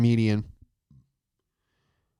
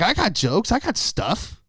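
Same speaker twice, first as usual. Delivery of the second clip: indignant inner rant. Retold or imitated. Comic fuming about the judge, high indignant whine. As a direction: rise into a disbelieving outraged whine. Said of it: "I'm like".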